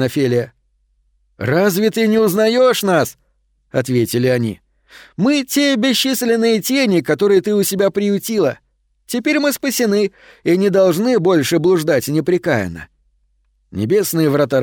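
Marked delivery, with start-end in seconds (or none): 1.4-3.2 high, slow, delighted question; joyful spirits. A narrator's reading. Rescued shadows greet the old lady, joyfully. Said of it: "они радостно смеялись". 5.2-12.9 high, elated heavenly voices; joyful spirits. A narrator's reading. Saved shadows thank the old lady, high and joyful. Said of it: "они радостно смеялись".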